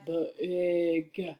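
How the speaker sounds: BIG drawn out in a put-on voice; emphatic big word. A narrator's reading. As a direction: stretch out BIG. Repeats the enlarged printed word.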